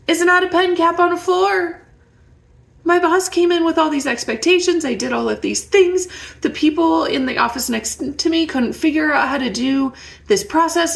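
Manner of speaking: frustrated venting. Acted. Inner voice venting the long day.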